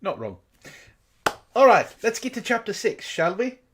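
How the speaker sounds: ordinary talk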